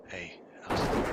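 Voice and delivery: raspy, voiceless greeting; lost voice. Retold or imitated. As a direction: croak it out with no voice left. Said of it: "I had lost my voice".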